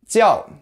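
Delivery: "Jiao" starting high and dropping sharply; falling tone. Shown as a demonstration. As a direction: drop "Jiao" from a high pitch. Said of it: "say with a falling intonation".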